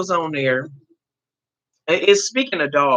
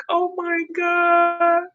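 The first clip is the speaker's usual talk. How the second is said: high, slow gasp of dismay; stunned oh my God; 12 semitones higher, much slower than usual. Retold or imitated. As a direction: let the shock stretch the words out. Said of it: "I was like".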